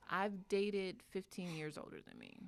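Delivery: normal talking voice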